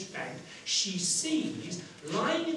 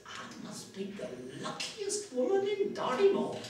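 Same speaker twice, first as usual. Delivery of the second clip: raised, put-on old countrywoman voice; old woman; 4 semitones higher than usual. Washerwoman finds only potato peelings, cheerful old-woman voice. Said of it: "how old and worn out she is".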